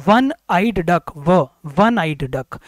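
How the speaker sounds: वन आइड डक said very slowly, वन starting with a w sound; slow demo phrase. Shown as a demonstration. Teacher sounding out a phrase slowly. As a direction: draw it out to show the w. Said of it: "व से start हो रही है".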